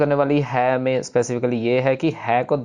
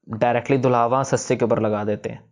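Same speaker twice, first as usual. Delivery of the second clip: normal talking voice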